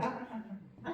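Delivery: high, soft stop-right-there noises; acted patronising hush; shushing interruption. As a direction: cut them off with small high syllables. He went, stopping the doctor.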